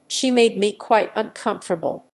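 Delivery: ordinary delivery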